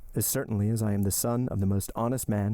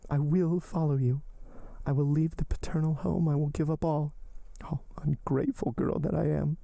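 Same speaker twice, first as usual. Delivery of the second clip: much higher, pleading heroine voice; desperate young woman; 6 semitones higher than usual. Acted. Heroine entreating her lover to live, high and pleading. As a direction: lift the voice and entreat him.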